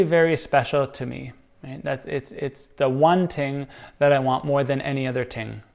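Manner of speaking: ordinary delivery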